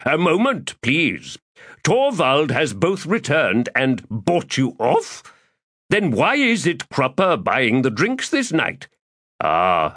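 florid, theatrical gentleman rogue; lofty, self-pleased orator; pompous Crupper voice. Acted. Crupper speaks.

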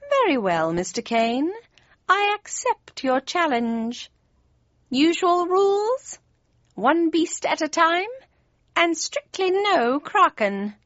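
high, slow cat voice; Cheshire Cat accepting a challenge. A narrator's reading. Cheshire Cat setting Kane the rules, high and deliberate. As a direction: climb high and draw out each term.

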